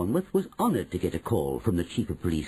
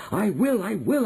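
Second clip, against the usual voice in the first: eager; 9 semitones higher, 4 dB louder than usual. A narrator's reading. Boy eager to go on mission.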